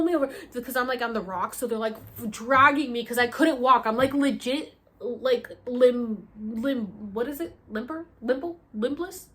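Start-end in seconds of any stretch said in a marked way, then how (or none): none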